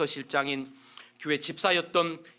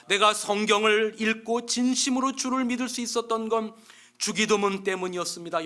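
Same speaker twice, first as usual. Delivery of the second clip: earnest preaching; 5 semitones higher, 4 dB louder than usual. Acted. Inmate preaching in another cell.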